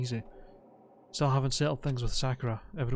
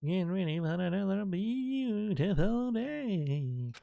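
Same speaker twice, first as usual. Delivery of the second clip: slow, higher, drawn-out mock announcement; drawling singsong imitation of a morning announcement; mimicked announcer; 6 semitones higher, 3 dB quieter, much slower than usual. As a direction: stretch it out high in a mocking echo of the announcer. Mimics the game announcer's morning call.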